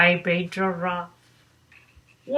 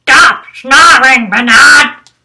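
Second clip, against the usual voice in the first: much louder angry yell; angry shout; 3 semitones higher, 13 dB louder, slower than usual. Acted. Zoo neighbour yelling at Bernard to stop snoring, loud. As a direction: bellow it at him, fed up.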